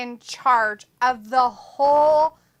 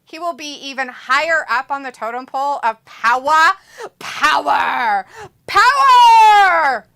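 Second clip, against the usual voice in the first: rising to loud shouted power; building to a shout; 10 dB louder than usual. Real, not acted. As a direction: shout power louder each time. Building rant about power.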